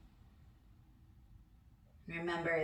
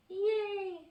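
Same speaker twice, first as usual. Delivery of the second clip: high, bright little cheer; a real burst of glee, pitched very high; cheerful yay; 14 semitones higher than usual. Real, not acted. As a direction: celebrate almost being done. Cheers near finishing the exercise.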